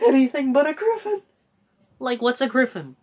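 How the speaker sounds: yelped Zoinks, then cartoon slacker whining; Shaggy impression. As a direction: panic like Shaggy at a monster. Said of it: "Like, that's Shaggy".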